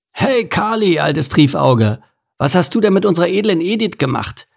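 high, jaunty teasing eel; cheeky eel Anton. Acted. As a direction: greet your old friend with a grin. Anton the eel arriving cheerfully.

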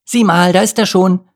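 high, loud excited pointing-out. A narrator's reading. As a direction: call it out, thrilled at the sighting. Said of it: "Aufgeregt wies sie". (aufgeregter, lauter Ausruf)

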